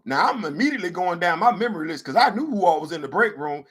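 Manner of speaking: ordinary talk, nothing marked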